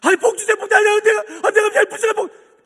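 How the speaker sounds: high, loud mock pleading; pushy, high acted supplication; begging prayer. Shown as a demonstration. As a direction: beg in a hammed-up, insistent wail. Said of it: "제발 좀 그렇게 기도하지 마세요".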